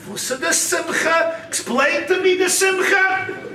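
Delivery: high, drawn-out demanding question; storyteller acting a baffled, demanding warden; insistent demand.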